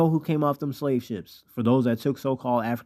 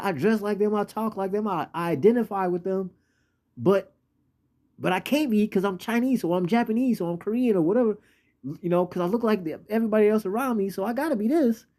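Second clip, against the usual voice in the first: higher-pitched, conflicted outsider voice; identity-crisis complainer; 9 semitones higher than usual. Acted. Torn man explaining why he can't belong, high-pitched protest. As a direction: plead his case in a raised pitch.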